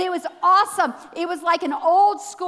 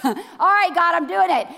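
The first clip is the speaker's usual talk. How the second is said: louder, determined "All right, God"; resolved burst; 3 semitones higher, 6 dB louder than usual. Retold or imitated. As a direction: psych yourself up out loud. Steeling herself to go up.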